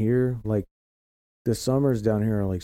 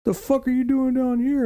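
high squeaky indignant quote; mocking high retort; 4 dB louder than usual. Locals scolding him for liking the cold, high and mocking. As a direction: mimic them in a shrill-high sneer. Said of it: "And they're like".

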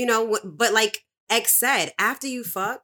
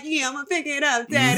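mock sweet reply; 5 semitones higher than usual. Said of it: "And I'm like".

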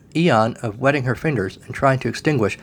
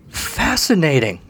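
drawn-out, higher gushing; 5 semitones higher, slower than usual. A narrator's reading. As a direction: swoon over it slowly. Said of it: "Constance gushed".

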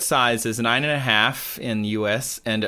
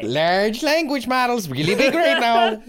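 mock grandiose; 8 semitones higher than usual.

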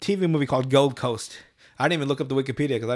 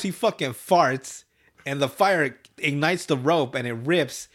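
usual speaking voice

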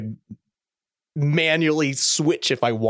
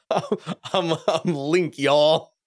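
playful Southern drawl, higher pitch; Southern accent; 5 semitones higher, much slower than usual. Acted. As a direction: lightly put on a Southern accent. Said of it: "you have this Southern accent".

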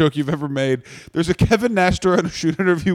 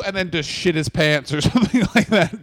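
usual speaking voice

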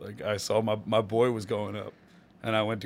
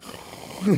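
dog whimper; 10 semitones higher than usual. Retold or imitated. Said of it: "why are you so good at dog sounds".

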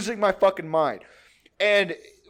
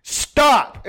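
raised, higher-pitched call to stop; mock mom yelling his name; mom scolding; 6 semitones higher, 6 dB louder, much slower than usual. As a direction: sharply tell him to quit. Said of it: "my mom's like".

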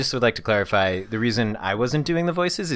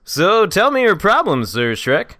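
loud, high comic impression; exaggerated stand-up comedian voice; Jerry Seinfeld; 10 semitones higher, 7 dB louder, slower than usual. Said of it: "I also wanna hear your Jerry Seinfeld".

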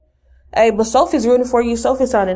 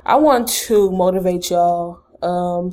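usual speaking voice

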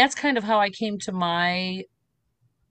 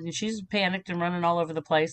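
ordinary delivery

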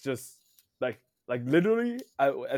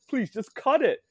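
high, loud begging; exasperated, loud appeal to stop; exasperated plea; 12 semitones higher, 7 dB louder than usual. Retold or imitated. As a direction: plead with them, voice pitched right up. Acted plea to trailer makers.

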